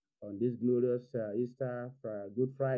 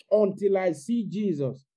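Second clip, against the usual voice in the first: determined; 7 semitones higher, 9 dB louder than usual. Retold or imitated. Mary's determined refusal.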